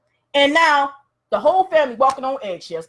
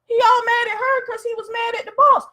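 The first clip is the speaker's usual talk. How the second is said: high, astonished remark about the TV scene; amazed viewer; 10 semitones higher than usual. Retold or imitated. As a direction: react in disbelief at the screen. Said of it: "We're like".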